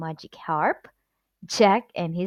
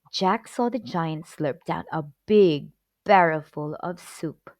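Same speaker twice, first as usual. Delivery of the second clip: plain reading voice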